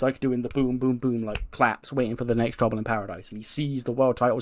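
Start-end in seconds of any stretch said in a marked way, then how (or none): none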